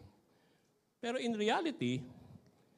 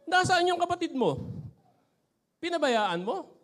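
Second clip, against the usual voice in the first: high, loud shrill-free scold, then slower; scolding mother; 10 semitones higher, 7 dB louder than usual. Retold or imitated. Mother scolds her daughter for leaving her brother, high and loud. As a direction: yell at the kid like an angry mom. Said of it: "Sabi ganun to".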